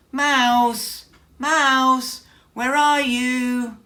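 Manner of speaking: high, very loud calls through the house; loud search call, slow at the end. Acted. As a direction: call for a missing friend. Bear calling out for Mouse.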